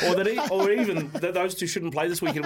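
talking through laughter; words bubbling with a laugh. Real, not acted. Laughing at his own joke.

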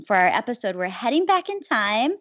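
normal talking voice

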